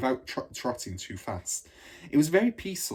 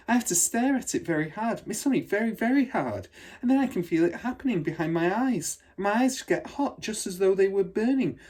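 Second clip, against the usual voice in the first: put-on higher, eager child's voice tumbling out; a thrilled young girl who can't stop talking; excited little girl; 8 semitones higher than usual. Matilda excitedly telling her powers.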